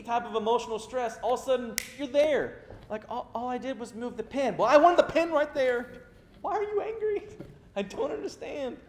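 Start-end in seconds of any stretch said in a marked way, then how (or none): 3.1-5.9 high, louder mock squabble over a pin; mock argument. Arguing with his wife over a pin, mock squabble. 6.4-8.8 mock confusion. Confused self after outburst.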